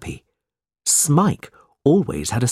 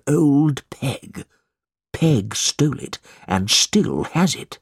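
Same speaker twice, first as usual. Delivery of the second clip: put-on slow, high, scheming old miser; old moneylender; 5 semitones higher, slower than usual. A narrator's reading. Mister Gride telling Ralph his secret, slow and sly. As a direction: savour the news like a greedy old man.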